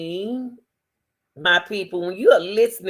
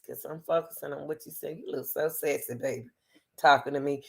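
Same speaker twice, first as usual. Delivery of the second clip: soft flirty coo; 4 semitones lower, 6 dB quieter than usual. Pretend. Flirting with the man.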